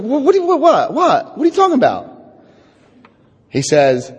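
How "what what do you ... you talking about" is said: high, laughing sputter of confusion; stammering, laughing what-what; baffled disbelief. Retold or imitated. Incredulous retold reaction to sponsor.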